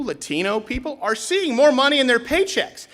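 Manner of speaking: ordinary talk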